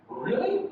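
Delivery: "Really?" climbing high at the end; rising intonation. Shown as a demonstration. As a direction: lift "Really?" upward in surprise. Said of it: "It's going up again".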